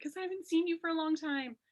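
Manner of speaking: a touch of crying in the voice as she explains; mimicked tearful reply. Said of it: "I just broke down crying".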